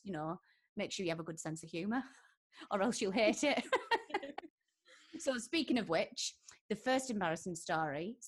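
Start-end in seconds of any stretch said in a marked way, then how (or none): 3.7-4.5 laughing. Real, not acted. Laughing at her own joke.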